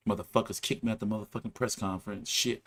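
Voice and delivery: muttering bitterly on the plane. Retold or imitated. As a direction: grumble it under your breath. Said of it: "I was so bitter".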